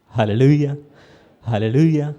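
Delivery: loud, ringing hallelujah; preacher's hallelujah. Real, not acted. Preacher's exclamation. (బోధకుడి గట్టి హల్లెలూయా)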